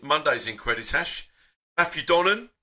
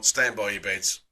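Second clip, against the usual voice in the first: ordinary delivery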